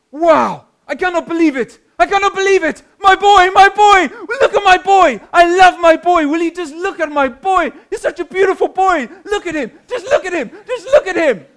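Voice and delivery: very high, loud South African cries of delight; hammed-up proud father beside himself, gushing at full volume; overjoyed South African dad. Proud dad watching his son win gold on live TV, ecstatic. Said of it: "He goes, forgive the accent".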